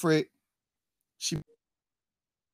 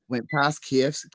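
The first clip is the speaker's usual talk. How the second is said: normal talking voice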